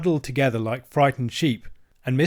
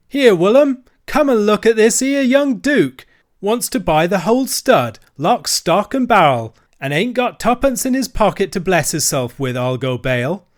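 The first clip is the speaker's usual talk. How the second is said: loud, higher mocking call; jeering dealer; 8 semitones higher, 8 dB louder than usual. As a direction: laugh at the boy to the ostler. Dealer jeers to ostler.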